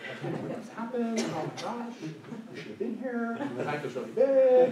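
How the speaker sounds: high, hammed-up breathless gossip; mock panicked retelling. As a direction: play the frantic witness calling friends. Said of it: "We'd be like".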